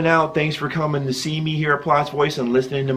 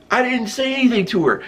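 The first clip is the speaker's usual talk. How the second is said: insist, rattled and higher; a guy about to be punched protesting higher; higher flustered protest; 7 semitones higher than usual. Retold or imitated. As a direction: pitch up, pleading innocence. Protesting again to attacker.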